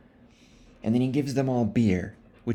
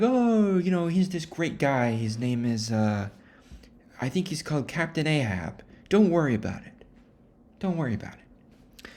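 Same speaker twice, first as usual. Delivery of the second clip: shifty, higher brushing-off voice; evasive high locals; 6 semitones higher than usual. Retold or imitated. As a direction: wave the worry away, too breezily. Locals evasive about captain.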